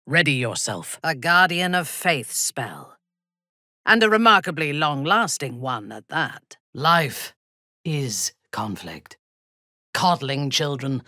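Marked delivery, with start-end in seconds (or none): none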